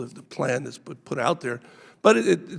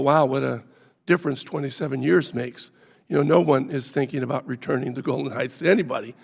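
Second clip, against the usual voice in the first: ordinary talk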